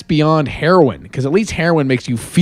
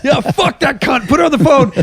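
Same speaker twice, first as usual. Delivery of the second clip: high-pitched phone rant, hammed up; exaggerated, high-voiced hostility; mock outrage; 11 semitones higher than usual. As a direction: imitate a friend egging on a fight. Retells friend's hostile phone reply.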